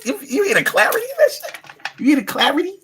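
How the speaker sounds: ordinary talk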